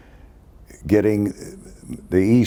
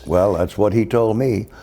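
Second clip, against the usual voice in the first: normal talking voice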